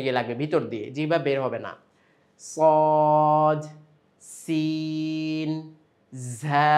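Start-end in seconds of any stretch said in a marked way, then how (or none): 2.4-6.7 সদ heavy, সিন held very slowly, ঝা light, each letter set apart; Arabic sad, sin, za demo. Shown as a demonstration. Teacher modelling three Arabic letters for students. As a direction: say সদ thick, then draw out সিন. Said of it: "এভাবে".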